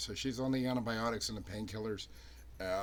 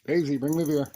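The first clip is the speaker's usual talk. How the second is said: loud, higher call to the dog; commanding a dog; 5 semitones higher, 11 dB louder than usual. Real, not acted. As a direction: call the command across the room.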